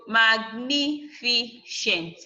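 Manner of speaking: "magnificent" broken slowly into mag, ni, fi, cent; syllable by syllable. Shown as a demonstration. Teacher breaking down a word for students, syllable by syllable. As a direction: sound out "magnificent" in four separate beats. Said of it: "four syllable".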